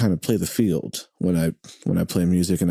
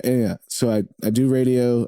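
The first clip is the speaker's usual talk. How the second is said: ordinary talk, nothing marked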